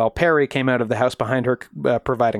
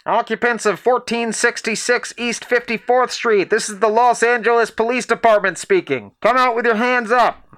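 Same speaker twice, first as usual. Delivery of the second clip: higher police bullhorn announcement; through a megaphone; 9 semitones higher than usual. Retold or imitated. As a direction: call it out like a bullhorn. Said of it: "A bullhorn rang out with the message".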